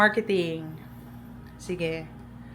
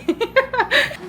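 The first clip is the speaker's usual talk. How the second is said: high laugh; 12 semitones higher, 5 dB louder than usual. Real, not acted. Laughs after bye.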